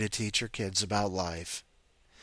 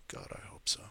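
whisper; 14 dB quieter than usual. Real, not acted. Tired host muttering aside.

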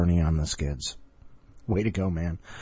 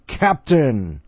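shouted; 5 semitones higher, 5 dB louder than usual. Acted. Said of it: "he shouted".

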